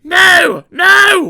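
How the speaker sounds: very high loud wail; wailing trucks. A narrator's reading. Empty trucks wailing in fear at the runaways. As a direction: cry out in panic. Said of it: "wailed the empty trucks".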